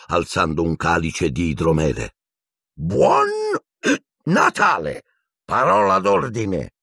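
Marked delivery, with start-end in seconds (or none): 2.7-6.7 high booming knight's toast, slowed on the password; hearty old knight calling out; jolly knight. A narrator's reading. Sir Cadogan toasting the boys, jolly and hearty.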